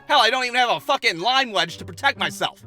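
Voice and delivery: ordinary talk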